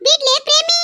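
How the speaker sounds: another woman's high voice breaking into the fight; shrill village woman. Acted. Neighbour woman pleading with Premi, high and pleading. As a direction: pitch up into a second character. One voice plays several quarrelling women.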